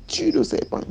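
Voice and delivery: high-pitched voiced order; high command. Nanny commanding the girl to kill the snake, high voiced. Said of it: "Elle me dit".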